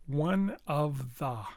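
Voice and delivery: "One of the" slowly, each word separate; very clear. Shown as a demonstration. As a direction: enunciate "One", "of", "the" one by one. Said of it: "should be very clear".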